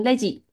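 レジ with both vowels short; no long vowel. Shown as a demonstration. Teacher corrects student's word for till, short-vowel レジ. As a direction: keep レジ short, don't stretch it. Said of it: "不要拉长音".

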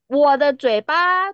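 ordinary talk

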